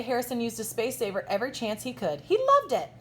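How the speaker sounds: normal talking voice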